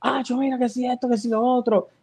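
mock hyped. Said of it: "con todo el ánimo del mundo".

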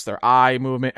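ordinary delivery

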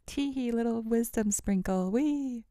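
high, playful fairy voice; little wisdom fairy. Pretend. Podcaster playing a wisdom fairy, high and twee. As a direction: sprinkle it out in a pretend fairy squeal. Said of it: "I feel like a little wisdom fairy".

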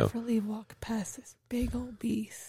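hushed sneaky whisper. Retold or imitated. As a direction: whisper as if tiptoeing past. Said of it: "She's like".

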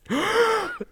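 sharp high gasp; dramatic gasp. Acted. Reader gasps at the enemy reveal, high gasp. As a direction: gasp as if shocked.

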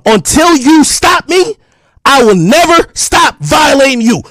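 drawn-out, forceful yell; slow heated shout; slow angry shout. Real, not acted. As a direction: lean into each word like a threat. Heated threat at rival.

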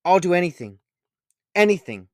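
loud, high desperate promise; emotional outburst. A narrator's reading. Boy clutching the stranger's coat, desperate. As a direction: burst out with it. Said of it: "exploding in every direction with emotion and tears".

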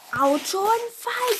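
high, loud, slow outraged roar; thundering Hagrid. A narrator's reading. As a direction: roar it in disbelief. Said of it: "donnerte Hagrid".

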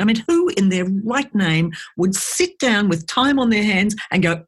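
normal talking voice

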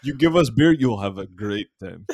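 mock Russian accent, loud opening. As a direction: put on a comic Russian accent. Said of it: "now that I did an accent".

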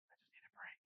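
breathless whispered aside; a tired whispered plea. Retold or imitated. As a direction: whisper it like a secret sigh. Congregation member's tired aside.

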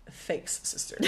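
soft, half-hearted mock gratitude; unimpressed mock thanks. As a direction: mumble a dutiful thanks, unconvinced. Acted deflated reply to the nun.

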